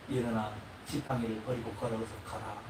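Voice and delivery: low, soft, tender send-off; gentle Jesus. Acted. Jesus tells the healed man to go home, low and tender. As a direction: quietly send him home in peace.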